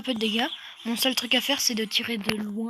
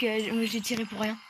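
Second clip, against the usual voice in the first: ordinary delivery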